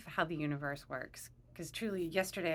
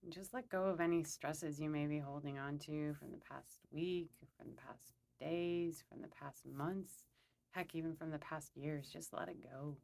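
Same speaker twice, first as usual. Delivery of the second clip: calm, unhurried meditation guide; guided meditation; 2 semitones lower, 6 dB quieter than usual. Acted. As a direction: lead them gently through a visualization, pausing between phrases.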